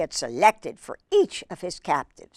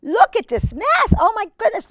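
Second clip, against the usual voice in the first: much higher, excited squeal; amazed exclamation; 13 semitones higher than usual. Real, not acted. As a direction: gasp at the mess in delight. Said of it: "repeated much higher".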